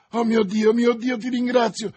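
high, loud, fervent thanks; relieved exclamation. A narrator's reading. Young lover thanking God she revived, relieved exclamation. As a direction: cry out thanks to heaven. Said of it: "esclamò il giovane".

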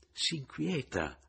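teasing wink. A narrator's reading. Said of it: "feci ammiccando a Romilda".

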